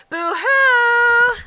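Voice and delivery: high, loud goat call; a long high call across the pasture; calling Boo-hoo. Acted. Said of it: "I'm just calling for Boo-hoo".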